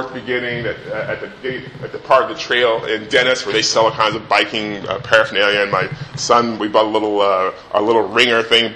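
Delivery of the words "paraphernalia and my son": ordinary talk, nothing marked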